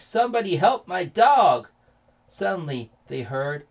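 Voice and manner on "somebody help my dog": loud, raised call for help; high, urgent storybook shout; pleading yell. A narrator's reading. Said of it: "yelled Mark".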